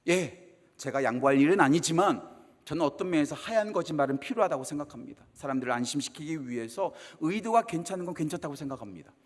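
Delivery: ordinary delivery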